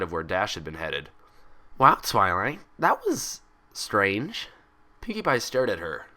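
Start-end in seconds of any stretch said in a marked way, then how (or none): 1.8-4.5 Pinkie's bouncy voice, drawn out slowly; bright and teasing, stretching strange; light, bubbly tone. A narrator's reading. Pinkie teasing Twilight after her outburst, light and bouncy. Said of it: "her tone light".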